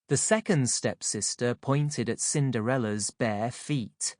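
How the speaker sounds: usual speaking voice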